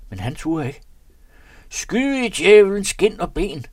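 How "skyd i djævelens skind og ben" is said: loud, slow, high cursing yell; furious, slow bellowed curse; shouted. A narrator's reading. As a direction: roar the order in fury and panic. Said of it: "råber han til Jens".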